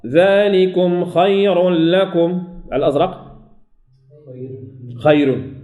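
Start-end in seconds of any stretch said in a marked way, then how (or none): 0.1-2.4 ذلكم خير لكم chanted slowly, the ra of خير thin; Quranic recitation. Shown as a demonstration. Teacher recites verse to show light ra. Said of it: "الصورة الأخرى".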